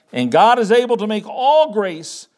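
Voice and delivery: "all" lifted and held; stress on all. Shown as a demonstration. Preacher repeating the verse to show his emphasis, "all" clearly stressed. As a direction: lean firmly on the word "all". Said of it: "I'm emphasizing the word all".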